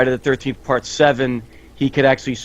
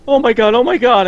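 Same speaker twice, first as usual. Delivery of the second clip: much higher, louder imitation of a frightened girl; mock panic; 11 semitones higher, 6 dB louder than usual. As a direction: play the scared girl hiding under the bed. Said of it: "you just hear going".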